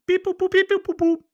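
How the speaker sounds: high, loud robot beeps and boops; droid beeping. Retold or imitated. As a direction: beep like R2-D2, way up high. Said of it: "makes the droid noises".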